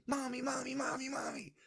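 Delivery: put-on very high-pitched excited child's cry; child screaming for mommy. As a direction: squeal it as a little boy running to his mother.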